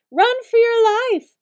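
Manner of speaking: high, loud, slow panicked shout. Acted. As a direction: yell a warning with everything you have. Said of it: "Bailey shouted".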